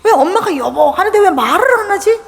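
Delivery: high-pitched questioning of the parents' behaviour; puzzled child. Acted. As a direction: wonder aloud like a bewildered kid. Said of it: "애들이 뭐".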